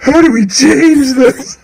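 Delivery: high, slow, laughing parody; mocking imitation. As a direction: echo his plea as a whiny joke. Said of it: "mocking his line".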